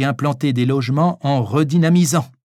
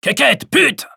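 shrill high outburst; high yelling; 13 semitones higher, 5 dB louder, slower than usual. Acted. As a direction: blurt it out high and loud. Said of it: "au moment où Francis hurlait".

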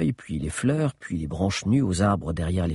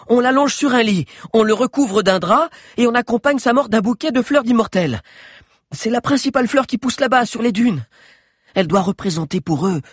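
high, loud excited rush; excited outburst; 6 dB louder than usual. Acted. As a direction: pour it out breathlessly. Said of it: "cria soudain Agnès".

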